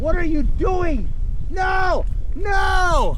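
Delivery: high, stretched-out shocked question; can't believe what the dog is doing; alarmed disbelief. Real, not acted. To his dog high in the tree, shocked.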